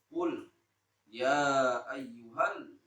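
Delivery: slow, drawn-out chanted recitation; Quran recitation. Acted. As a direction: intone the verse slowly.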